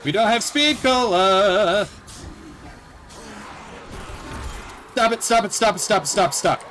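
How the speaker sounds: high desperate pleading; angry crying. Real, not acted. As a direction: beg the zombies to stop. Panicking, zombies swarming.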